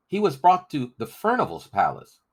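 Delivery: a boy blurting bad news; urgent boy's voice. Crispin tells the rebels where Bear was taken, urgent boyish voice.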